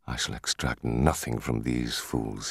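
low, slow mutter; hushed, low-pitched aside. A narrator's reading. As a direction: mutter it softly to yourself. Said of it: "he muttered".

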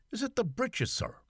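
puzzled valet asking back; lightly voiced Irish valet; Barney's voice.

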